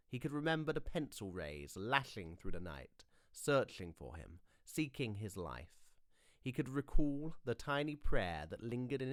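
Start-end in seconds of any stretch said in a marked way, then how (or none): none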